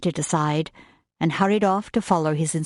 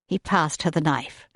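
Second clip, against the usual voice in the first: ordinary delivery, nothing marked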